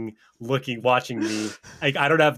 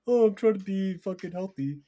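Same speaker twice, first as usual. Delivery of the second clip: higher, whiny mocking voice; mocking cyclist; 8 semitones higher than usual. Mocking cyclists to his friend, in a higher whiny voice. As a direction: sneer it like a smug gym cyclist. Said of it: "What was that?".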